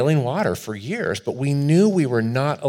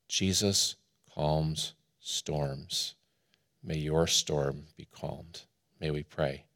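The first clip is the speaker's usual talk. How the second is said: slow, low, very soft close; calm, hushed call to prayer; hushed blessing; 5 semitones lower, 10 dB quieter, much slower than usual. Real, not acted. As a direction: settle slowly into a quiet benediction. Closing blessing leading into prayer.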